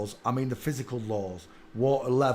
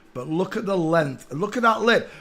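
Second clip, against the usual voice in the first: normal talking voice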